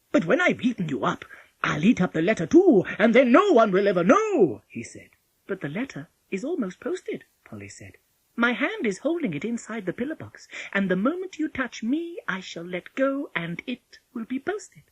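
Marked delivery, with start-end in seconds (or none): 0.1-4.6 loud, higher gleeful wolf; cheered up. A narrator's reading. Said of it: "Then he cheered up". 5.5-14.8 put-on higher, lighter voice of a bold child; Polly, a cool little girl. A narrator's reading.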